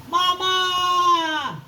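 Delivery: long high holler, falling at the end; yelling for mama. Acted. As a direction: call out loudly for your mother. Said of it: "And she yelled for Mama".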